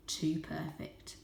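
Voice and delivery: slow eerie whisper. A narrator's reading. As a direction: let it chill the listener. Eerie narration.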